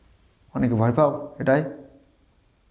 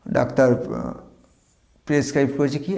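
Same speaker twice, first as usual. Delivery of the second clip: usual speaking voice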